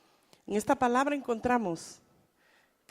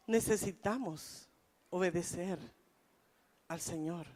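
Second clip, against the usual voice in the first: soft earnest appeal; 7 semitones lower, 10 dB quieter than usual. Real, not acted. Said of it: "quiet earnest appeal".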